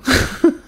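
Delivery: quick high giggle at her own joke; a little amused laugh; light self-amused laugh; short laugh. Real, not acted.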